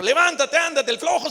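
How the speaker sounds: wife yelling at her lazy husband; angry yell. Acted.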